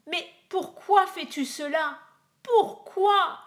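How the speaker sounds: high and loud, rising to a cry; a desperate mother screaming why; distraught mother shouting at her husband; anguished scream. A narrator's reading. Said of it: "hurla la pauvre mère".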